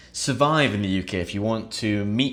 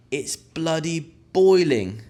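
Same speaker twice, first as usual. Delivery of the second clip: slow, higher moan; mock heat complaint; 5 semitones higher, slower than usual. Shown as a demonstration. Teacher acting out a hot-day moan, slow and higher. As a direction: drag it out like it's sweltering.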